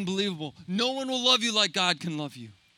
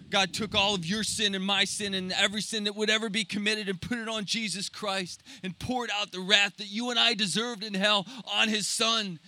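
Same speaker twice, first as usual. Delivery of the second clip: normal talking voice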